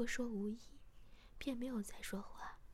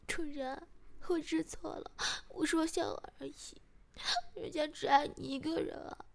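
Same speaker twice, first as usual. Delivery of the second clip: high, louder tearful pleading; crying; 5 semitones higher, 6 dB louder than usual. A narrator's reading. Partner apologising to the master in pain, crying. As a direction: beg forgiveness as if in tears. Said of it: "马上哭着说".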